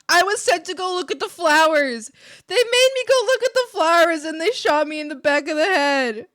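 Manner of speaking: loud, high indignant wail; hammed-up outcry. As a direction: shout it in high-pitched mock outrage. Acted outburst of a shot character.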